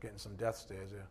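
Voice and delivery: ordinary talk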